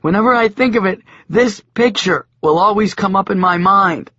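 high, loud, fast complaint; high hurried lament; dismayed Joshua. A narrator's reading. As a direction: rush it out, still appalled. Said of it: "Joshua said".

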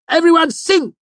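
very high, loud rallying shout; showman's piercing call to join in. A narrator's reading. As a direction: shout to rouse a crowd. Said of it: "he shouted".

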